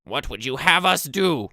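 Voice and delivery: high, loud shouted goblin voice; high-pitched goblin hail, drawn out at first. Acted. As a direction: shout it as a goblin announcing himself. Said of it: "this goblin".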